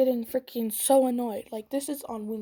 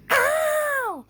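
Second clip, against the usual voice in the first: high, loud fake cry of pain; mock ow; 7 dB louder than usual. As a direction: yelp as if hit. Girl cries out, not hit.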